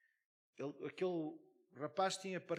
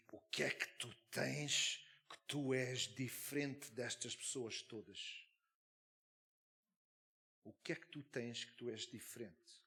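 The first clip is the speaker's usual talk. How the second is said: thick voice; 3 semitones lower than usual. Retold or imitated. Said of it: "com uma voz grossa".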